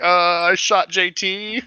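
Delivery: high, slow mock wail; drawn-out pretend distress; imagined confession. Imagining the shooter confessing at the homestead, mock wail. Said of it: "I can just imagine her being like".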